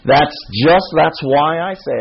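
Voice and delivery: ordinary delivery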